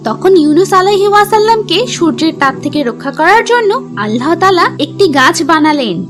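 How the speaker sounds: plain reading voice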